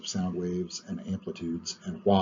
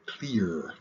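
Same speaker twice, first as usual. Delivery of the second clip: ordinary talk, nothing marked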